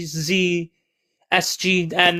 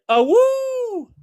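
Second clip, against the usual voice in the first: wolf howl. Retold or imitated. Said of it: "Aika said".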